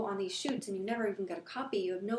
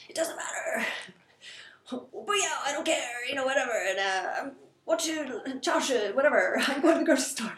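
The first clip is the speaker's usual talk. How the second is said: mock yelling back; 3 semitones higher, 4 dB louder than usual. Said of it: "I already know how to yell back".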